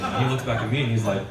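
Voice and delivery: ordinary delivery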